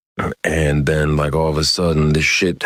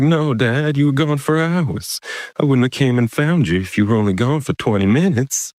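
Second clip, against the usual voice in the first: very high, insistent mock-child voice; protesting son; 10 semitones higher than usual. Son insists to dad he was gone for hours, high protest. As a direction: argue back like an upset boy, pitched way up.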